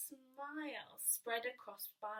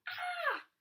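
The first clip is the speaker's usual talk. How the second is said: high shrieking "Ah!" of fright, much higher and louder; 5 dB louder than usual. Acted. Little Bear shrieking at the lumpy flapping sheet. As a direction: let out a startled shriek. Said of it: "He shrieked".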